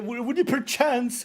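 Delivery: high, drawn-out flowery proposition; mock courtly suitor. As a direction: woo in a high, over-polite put-on voice.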